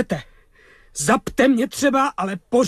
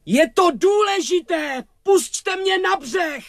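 higher, drawn-out plea called across water; urgent calling out; slower than usual. Acted. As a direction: call out, insisting it matters. Pleads with soldier from boat. (naléhavé volání)

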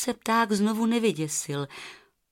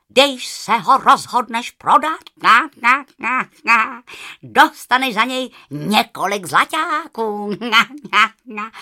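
loud honking goose with a laugh; 3 semitones higher, 8 dB louder than usual. Acted. The goose tells Rozárka the feather is worth gold, loud honking. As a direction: honk the promise gleefully.

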